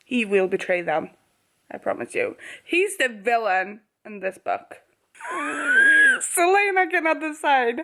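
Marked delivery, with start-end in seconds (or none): 5.2-6.1 a loud, very high squealing laugh. Real, not acted. Loving the villain, loud high squeal of laughter. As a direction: squeal with delight.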